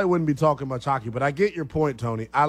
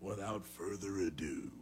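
announcer voice; 5 semitones lower, slower than usual. Acted. Announces the show segment.

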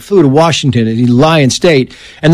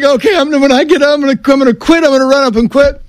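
put-on much higher, whiny boy's thoughts; squeaky kid muttering while running laps; kid inner voice; 10 semitones higher, faster than usual. Eight-year-old running laps, planning to quit, high whine. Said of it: "I'm like".

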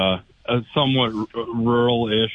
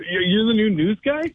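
excited disbelief; 11 semitones higher than usual. Real, not acted. Caller to the new news guy, high excited disbelief.

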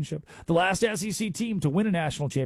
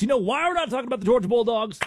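ordinary talk, nothing marked

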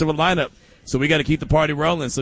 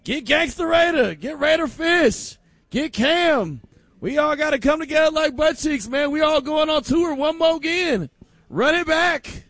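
high hyped shouting call to get the band on tour; rally the crew like a reunion tour hype man; 12 semitones higher than usual. Retold or imitated. Host imitating a band calling everyone back on tour, high and hyped. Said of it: "Remember, they're like".

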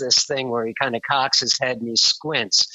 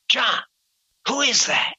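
higher, eager question; intrigued question; 8 semitones higher than usual. Retold or imitated. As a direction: lean in, dying to know. Prine asks, intrigued.

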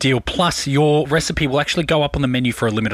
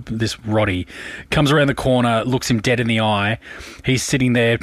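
usual speaking voice

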